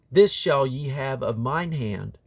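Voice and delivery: usual speaking voice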